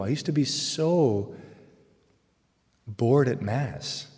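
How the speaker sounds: bored stretched slow and falling; slow, weary bored at Mass; drawn-out bored. Shown as a demonstration. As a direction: draw out the word bored like a yawn. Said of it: "drawn-out bored".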